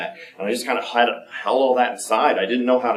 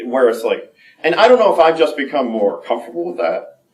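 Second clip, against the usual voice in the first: normal talking voice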